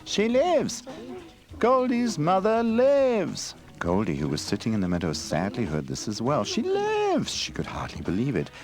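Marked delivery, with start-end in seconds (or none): 0.0-3.5 high, loud, slow jubilant cry; excited chorus. A narrator's reading. Forest animals spread the news, jubilant cry. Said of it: "rustling through the magic forest". 6.4-7.3 disbelieving cry. A narrator's reading. Goldie hears unbelieving.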